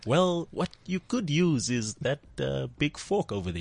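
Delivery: put-on slow, soft New Zealand accent; gentle movie alien, drawn out; unhurried, quiet New Zealand character voice; Korg impression. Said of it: "It's like".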